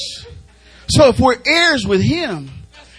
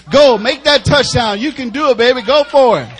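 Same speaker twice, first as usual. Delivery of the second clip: louder coach-style encouragement from the touchline; sideline cheering; 3 semitones higher, 7 dB louder than usual. Retold or imitated. Father cheering his son from the sideline, loud encouragement. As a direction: urge them on like a football fan. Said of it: "on the sideline like ... in a football game".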